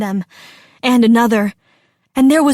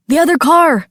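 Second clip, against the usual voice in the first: raised, louder alarmed call; urgent cry; 5 semitones higher, 6 dB louder than usual. A narrator's reading. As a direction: cry out a warning. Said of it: "Daniel cried".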